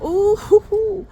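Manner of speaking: high, gleeful rising whoop; excited. Retold or imitated. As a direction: imitate teens thrilled by new freedom.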